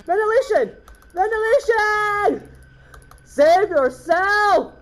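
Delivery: high, loud, drawn-out cries; frantic yelling. Real, not acted. Player shouting about failing ventilation, frantic yelling. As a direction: call out as if in an emergency.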